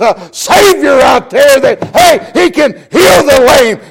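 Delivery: loud, high, shouted preaching that builds to the end; preaching at full force. Acted. Preacher telling the lost that Jesus can save them, full shout. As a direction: thunder it out as a call to the lost.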